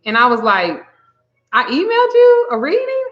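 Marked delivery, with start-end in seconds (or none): 1.5-3.0 high-pitched disbelief; incredulous question. Retold or imitated. Reader questions a client's email claim, high and incredulous. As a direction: shoot way up high as if stunned.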